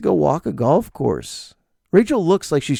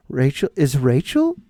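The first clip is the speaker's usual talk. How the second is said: usual speaking voice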